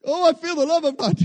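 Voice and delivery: high, loud, swooning cry of delight; a big hammed-up rapture; blissed-out woman. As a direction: gush it out like she's floating. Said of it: "She goes".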